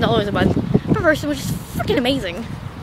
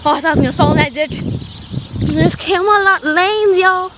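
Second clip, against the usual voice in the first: ordinary delivery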